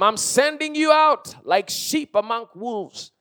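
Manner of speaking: slow, high, weighty proclamation; deliberate pulpit declamation; emphatic preaching. Real, not acted. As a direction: stretch out the warning for the congregation. Preaching at full force.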